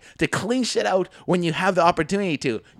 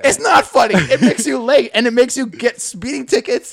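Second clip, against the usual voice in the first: laughing anger; 4 semitones higher, 6 dB louder than usual. Real, not acted. Heated rebuttal with laughter.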